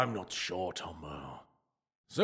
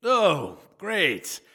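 bright and eager, louder; 7 semitones higher, 6 dB louder than usual. Acted. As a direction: perk right up at the news. Reacting eagerly to news of killing.

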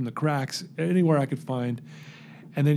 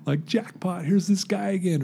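high-pitched mock amazement; excited retold disbelief, lifted high; imitating delighted girl; 6 semitones higher than usual. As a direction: play a kid who just hit the jackpot, voice pitched up. Voices the delighted girl's reaction.

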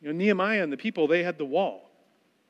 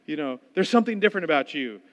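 mock eager convert; 3 semitones higher, 7 dB louder than usual.

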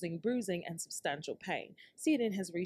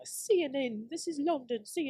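British news anchor voice; British accent; 9 semitones higher, 3 dB louder than usual. Pretend. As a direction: read it like a London newscaster. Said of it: "where they hire British people".

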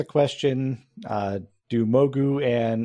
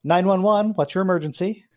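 911 operator; 6 semitones higher, 3 dB louder than usual. Acted. GM plays 911 dispatcher.

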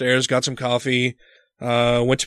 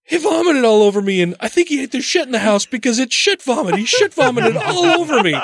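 higher, frantic telling; 9 semitones higher than usual. Retold or imitated. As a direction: blurt it out in disgust. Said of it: "I'm like".

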